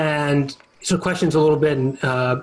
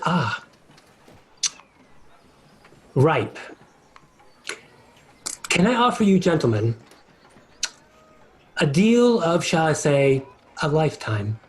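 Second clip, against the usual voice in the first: sly devil. Acted. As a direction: savour the bait like a patient fiend. GM voices devil offering deal.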